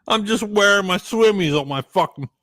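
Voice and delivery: ordinary talk, nothing marked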